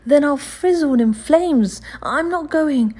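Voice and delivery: put-on high, loud, defiant boy; boy defying the sweep, high and loud; firmly. A narrator's reading. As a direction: stand your ground as a child. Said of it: "replied Oliver firmly".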